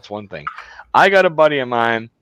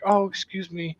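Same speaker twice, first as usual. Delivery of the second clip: higher, soft secretary voice; imitated secretary; 7 semitones higher, 6 dB quieter than usual. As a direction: lightly mimic a polite secretary, pitched up. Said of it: "my secretary is standing there".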